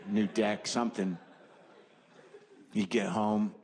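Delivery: ordinary talk, nothing marked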